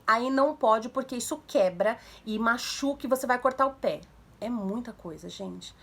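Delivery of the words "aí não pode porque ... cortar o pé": put-on louder, unbroken lecture to a child; run-on scolding. Shown as a demonstration. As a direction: pile up warnings in one breath. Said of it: "Nada de assim". (sermão corrido, em voz mais alta)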